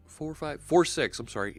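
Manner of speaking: ordinary talk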